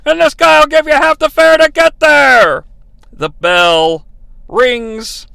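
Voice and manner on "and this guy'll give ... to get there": high, loud commentator impression; hammed-up Gorilla quip; mocking Gorilla. Gorilla adding a jab, high and loud. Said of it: "And Gorilla adds".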